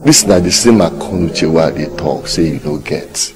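ordinary delivery

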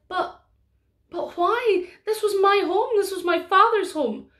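put-on a girl begging not to be sent away; young Mary pleading.

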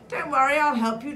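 high and hearty offer of rescue; eager reassurance. A narrator's reading. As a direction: pipe up keen to help. Mole calls to trapped rabbit.

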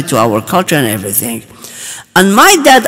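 normal talking voice

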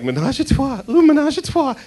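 high, giddy cooing at the wine name; hammed-up giddy ooh over the label; giddy mock swoon. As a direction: coo the name like it's naughty. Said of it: "everyone's like".

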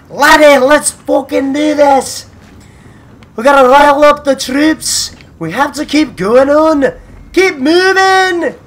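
loud, high Scottish rallying cry; rally the troops in a rough Scottish accent; attempted Scottish accent. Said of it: "trying to practice my Irish or Scotland accent".

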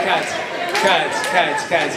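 firm director's cut call. Acted. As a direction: stop the scene like a director on set. Calling cut on a scene.

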